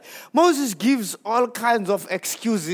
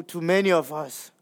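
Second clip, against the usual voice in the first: normal talking voice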